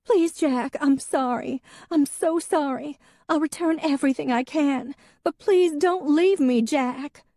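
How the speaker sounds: tearful wife begging him to stay; sobbing plea. A narrator's reading. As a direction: plead through tears. Sobbing wife begs husband.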